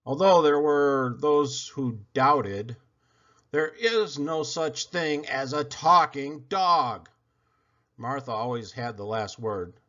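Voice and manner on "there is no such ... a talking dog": ordinary delivery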